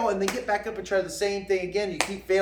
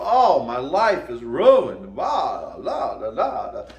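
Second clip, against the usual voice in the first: mock whining; 6 semitones lower, 4 dB louder, slower than usual. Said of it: "He's not going to go".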